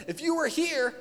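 high, slow, drawn-out complaint; exasperated Martha. Retold or imitated. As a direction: plead it like a grieving sister who was let down. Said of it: "like Martha of saying".

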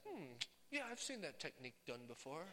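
quiet, lightly voiced know-it-all scout; pondering Royal Ranger. Royal Ranger watching water poured on wood, mildly pondering. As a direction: muse softly, a hint of sage scout. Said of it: "a Royal Ranger, would be like".

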